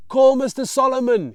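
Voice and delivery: loud, high call to the road crew; urgent shout to a worker; shouting out the window. Acted. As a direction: yell it out of a truck window. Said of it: "he shouts out the window".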